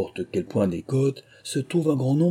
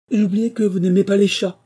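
high, loud and contrite; eager high-pitched apology; lively apologetic exclamation; 10 semitones higher, 7 dB louder than usual. A narrator's reading. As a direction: blurt it out apologetically, pitched well up. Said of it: "s'écria vivement Alice".

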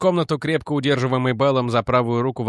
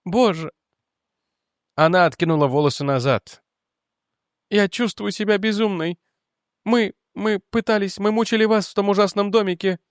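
higher, breathless confession; distraught young woman; 8 semitones higher than usual. Acted. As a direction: spill it out in distress. Jennet voiced higher.

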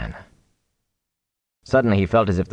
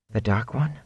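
soft, frightened breath of a question; a hushed, fearful whisper of a question; 4 semitones higher, 6 dB quieter than usual. A narrator's reading. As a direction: barely voice it, afraid of the name. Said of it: "Egwene breathed a question".

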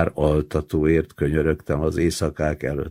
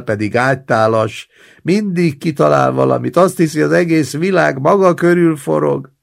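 indignant complaint; 6 semitones higher, 4 dB louder than usual. Acted. Roommate complains about the old man.

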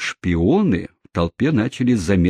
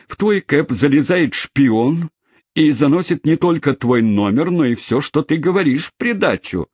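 appeal to crowd; 7 semitones higher than usual. Acted. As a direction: rouse the onlookers against the spy. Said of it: "апеллировал возница к толпе".

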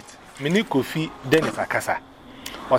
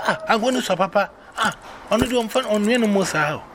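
imitating him, higher and laughing; mock laughing praise; 6 semitones higher than usual. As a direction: retell his delighted laughing line. Husband praising the oil, retold.

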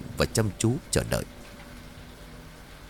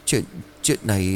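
stammering. Acted. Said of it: "lắp bắp nói không thành câu".

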